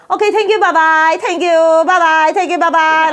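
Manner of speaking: high sing-song mock farewell, repeated; Chinese takeout goodbye. Takeout worker shoos a customer out, high mock goodbye. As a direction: chirp it insistently to hurry someone out. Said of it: "they talk to you when they say goodbye".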